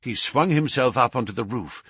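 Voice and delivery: usual speaking voice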